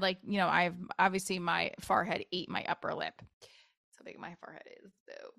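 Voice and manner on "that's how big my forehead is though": breathy whispered joke; whispered self-mocking aside. Real, not acted.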